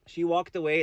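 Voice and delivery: normal talking voice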